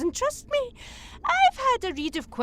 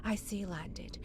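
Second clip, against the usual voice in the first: hushed, breathy self-correction; whispered aside; 4 semitones lower, 13 dB quieter than usual. Acted. Villain muttering a correction to his listener, whispered. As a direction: drop to a whisper to correct yourself.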